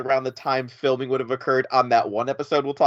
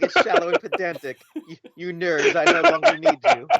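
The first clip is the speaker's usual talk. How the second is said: mock snob through laughter; laughing mock put-down; 12 semitones higher than usual. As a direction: tease while cracking up, pitch climbing. Listener mocks the snob while laughing.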